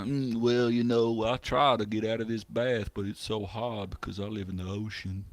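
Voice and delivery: a mild Southern gentleman fish, sighing. Retold or imitated. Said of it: "more of a southern gentleman".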